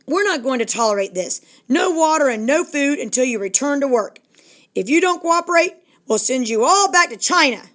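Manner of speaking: rising to a high, loud threat; angry boss threat. Acted. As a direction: bark orders at the strikers. Bosses threaten striking workers.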